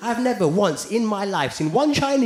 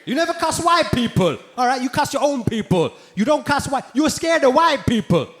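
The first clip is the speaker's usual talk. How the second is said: higher-pitched mock accusation; angry Sikh messager; 6 semitones higher than usual. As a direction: accuse him as an offended Sikh viewer. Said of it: "I got messages from Sikh people".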